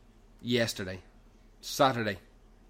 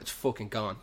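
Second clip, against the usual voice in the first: ordinary talk, nothing marked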